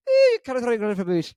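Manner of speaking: put-on high, loud, rapid Scottish accent; Scottish relative. Relative in Scotland scolding, fast thick Scottish voice. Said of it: "That's the way it said".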